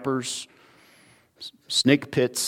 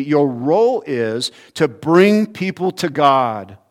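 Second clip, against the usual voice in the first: full-force preaching; 2 semitones higher, 6 dB louder than usual. Acted. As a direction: pound home the warning from the pulpit.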